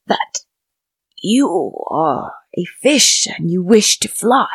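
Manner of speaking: put-on slow, breathy old fish whisper; an ancient fish whispering it out; slow deep whisper. A narrator's reading. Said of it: "in a slow, deep voice".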